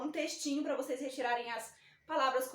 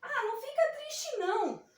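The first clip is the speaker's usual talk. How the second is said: comforting letter O; 8 semitones higher than usual. Pretend. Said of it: "E falou assim".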